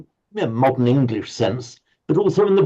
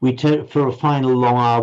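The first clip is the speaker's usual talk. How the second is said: ordinary talk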